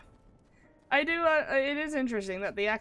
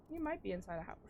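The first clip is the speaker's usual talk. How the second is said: normal talking voice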